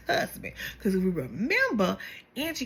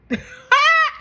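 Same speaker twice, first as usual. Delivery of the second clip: high burst of laughter; 8 dB louder than usual. Real, not acted. Narrator dies laughing.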